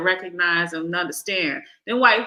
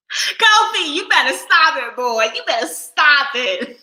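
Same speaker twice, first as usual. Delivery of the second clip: loud, high mock telling-off; amused, high-pitched reprimand; playful scolding; 6 semitones higher, 7 dB louder than usual. Real, not acted. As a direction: scold loudly on the edge of laughter. Playful scolding of a funny commenter.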